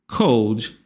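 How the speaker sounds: normal talking voice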